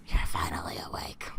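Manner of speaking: very low, whispery villain voice; deep hushed captor greeting the captive; captor's voice. Acted. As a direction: put on a low, breath-heavy captor. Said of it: "That's how he talks".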